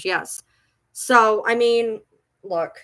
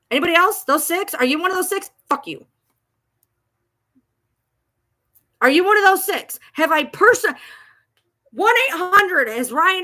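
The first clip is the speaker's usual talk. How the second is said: angry challenge; 8 semitones higher, 6 dB louder than usual. Real, not acted. Angry rant at trolls.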